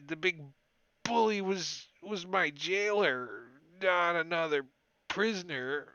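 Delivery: a cold frightened prisoner stumbling over his words; shivering prisoner. Acted. Prisoner accuses the goblin.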